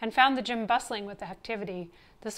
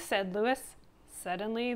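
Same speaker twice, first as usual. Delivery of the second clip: plain reading voice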